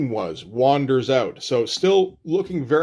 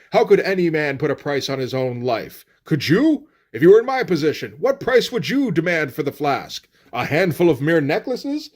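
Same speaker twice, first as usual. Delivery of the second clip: theatrical, indignant haggling speech; mock diatribe; 2 semitones higher than usual. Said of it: "starts to go into a diatribe".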